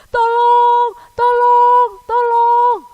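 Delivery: very loud, high repeated cries; acted full-voice distress call; shouting for help. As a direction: yell each cry for help long and high. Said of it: "ada orang berteriak-teriak".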